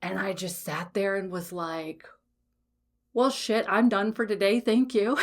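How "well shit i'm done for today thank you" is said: higher-pitched mock sign-off, breaking into laughter; mock quitting. Client jokingly bowing out to her coach after a hard truth, mock quitting. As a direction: brush it off brightly, then laugh.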